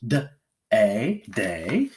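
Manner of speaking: "day" in pieces, with a full ay; long A. Shown as a demonstration. Teacher reading an open syllable, long ay in "day". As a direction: sound out "D, a" with ay, then blend "day". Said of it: "read it with long A".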